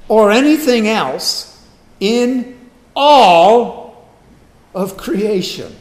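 slow and loud, a huge "all" set apart by pauses; sweeping emphasis. Retold or imitated. Preacher quoting Paul's final catch-all, sweeping emphasis on "all". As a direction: space it out, hammering "all" loud and long. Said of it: "finally says it this way".

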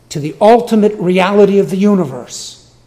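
"ultimate" driven high and loud, the whole phrase proclaimed; preacher pressing the point home, loud and high; emphatic proclamation. Real, not acted. Emphatic sermon climax.

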